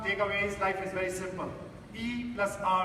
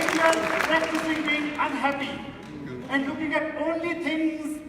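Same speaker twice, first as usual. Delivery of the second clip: louder, higher, driving the point home to the hall; forceful preaching; 6 semitones higher, 7 dB louder than usual. Acted. Speaker warns the audience about practicing anger, preaching loudly.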